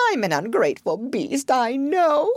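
high-pitched acted sob; sobbing. A narrator's reading. Toad admitting ingratitude to Rat, mock sobbing. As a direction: wail it as a tearful storybook confession. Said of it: "sobbed Toad, shedding bitter tears".

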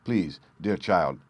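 plain reading voice

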